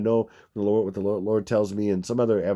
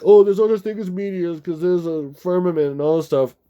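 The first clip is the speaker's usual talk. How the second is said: mocking commenter; 6 semitones higher, 4 dB louder than usual. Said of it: "They're like".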